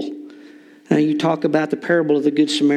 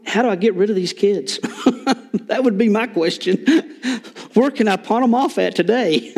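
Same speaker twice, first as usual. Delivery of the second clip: laughing mock question; 5 semitones higher than usual. Jokes as a homeschool mom.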